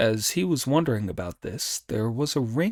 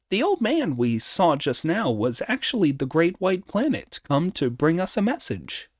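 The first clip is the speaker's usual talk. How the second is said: higher, animated monkey voice; Monkey, reporting; 6 semitones higher than usual. Acted. Monkey telling Sanzang about the messenger, animated. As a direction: report it in a high, lively voice.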